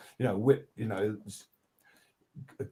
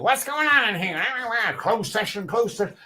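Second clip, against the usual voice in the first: high, loud strange lizard character; a fussy creature barging in; 10 semitones higher, 5 dB louder than usual. Retold or imitated. Lizard character interrupting session, high and loud. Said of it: "lizard comes in".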